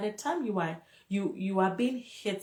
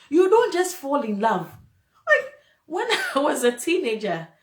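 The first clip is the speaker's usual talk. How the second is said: raised, louder, worked-up insistence; emotional and aggressive; 5 semitones higher, 7 dB louder than usual. Real, not acted. Warning listeners about falling in love, emotional and aggressive. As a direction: get heated as you drive the point home. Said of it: "getting all emotional and aggressive".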